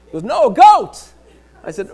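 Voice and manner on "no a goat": loud, high shout on "goat"; exasperated correction. Acted. Caller corrects a repeated mishearing, exasperated shout. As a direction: shout it to get through to a poor listener.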